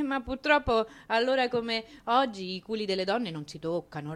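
usual speaking voice

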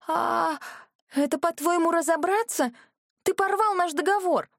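high, rising disbelief; shocked outburst. A narrator's reading. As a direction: blurt it out in shock. Heroine shocked as deal is torn.

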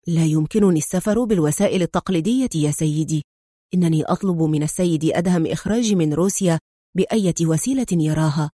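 ordinary delivery

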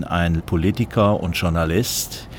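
usual speaking voice

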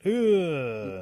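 high, loud "ugh" of revulsion; loud disgusted groan. Acted. All animals hearing of the tail, loudly disgusted. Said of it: "all the animals went".

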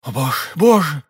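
usual speaking voice

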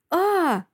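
high-pitched startled squeal; short high yelp. A narrator's reading. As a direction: squeal in alarm. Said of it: "верещит она".

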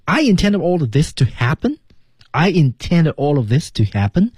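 "I intended all of this to happen" as one unbroken phrase, pitched high; no pauses. Shown as a demonstration. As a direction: run from "intended" to "happen" without a break. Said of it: "后面是没有什么停顿的". (高音连贯读"I intended all of this to happen"，中间不停顿)

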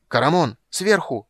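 high loud warning yell; warning shout. A narrator's reading. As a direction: yell the warning across the fight. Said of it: "крикнул рыцарь".

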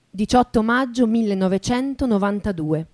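ordinary talk

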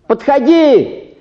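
long high call. Acted. Clerk calls the next peasant in, long high call. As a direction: drawl it out to the waiting room.

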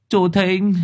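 high second call at the door. A narrator's reading. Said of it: "Gọi một hồi".

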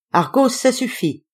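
high little voice calling the dog off; mild, high-pitched scolding cry; small-voiced cry. A narrator's reading. Woman tugging the leash scolds her dog, a small high cry. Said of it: "cria-t-elle de sa petite voix".

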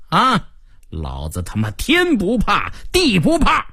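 taunting boss building to high defiance; defiant gang boss. Acted. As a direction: start confident, end in a high boast. Narrator voices gang boss. (老大越说越嚣张)

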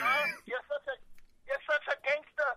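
lightly hammed-up Irish brogue, mocking; Conor McGregor Irish accent. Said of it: "I love [name]'s Irish accent".

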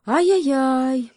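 high, loud, drawn-out hare wail; wailing lament. A narrator's reading. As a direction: lament with exaggerated sympathy. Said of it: "запричитал заяц". (громкое высокое причитание)

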